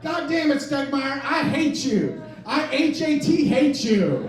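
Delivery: loud, high furious yell; angry shout. Retold or imitated. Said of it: "And he went".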